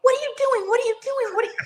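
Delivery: high, repeated exasperated yell; yelling at the game. Retold or imitated. Amy watching football, high exasperated yelling at the screen. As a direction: hammer the question at the TV. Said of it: "vintage Amy when I'm watching a football game".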